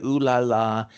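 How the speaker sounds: teasing sultry coo; mock sultry ooh la la. Women at the party eyeing the guy, sultry coo. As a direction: coo it like flirting. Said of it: "they're just like".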